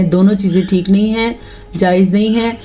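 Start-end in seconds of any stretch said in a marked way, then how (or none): none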